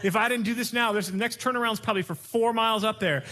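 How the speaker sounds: high, fast defensive retort; defensive husband. Retold or imitated. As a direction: snap back to defend the driving. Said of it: "You wanna go".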